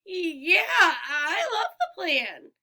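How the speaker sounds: shaky, nervous laughing cry; nervous gulp. A narrator's reading. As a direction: force out the words with a nervous laugh. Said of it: "Grover gulped".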